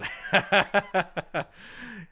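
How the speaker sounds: a burst of high laughter at his own joke. Real, not acted. Laughing at his own cowboy joke to chat, high and amused. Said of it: "yeah, it is".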